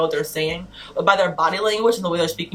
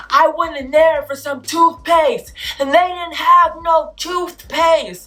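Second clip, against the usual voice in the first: loud, high, drawn-out mock rant; hammed-up angry man; angry imitation; 9 semitones higher, 5 dB louder, slower than usual. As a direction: imitate him yelling his complaint, stretching the words. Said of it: "he was upset and he was angry".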